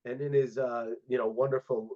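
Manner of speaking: ordinary delivery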